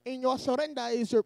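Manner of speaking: Irish attempt sliding into Indian; botched Irish accent. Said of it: "I was trying to be Irish. I went Indian".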